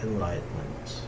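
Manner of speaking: ordinary delivery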